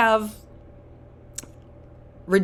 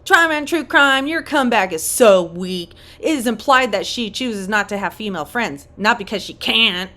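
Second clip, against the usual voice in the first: imitating her talk; 3 semitones higher, 5 dB louder than usual. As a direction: mimic her, loud and emphatic. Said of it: "That's how she talks".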